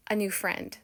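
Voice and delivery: usual speaking voice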